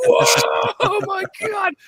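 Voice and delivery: very high, loud startled whoa. Retold or imitated. Kid startled by the first zombie, high loud whoa. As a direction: jump at the zombie turning. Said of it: "I was like".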